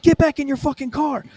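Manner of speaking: high, insistent re-enacted order; acted command. Cop ordering driver back into car, acted heated command.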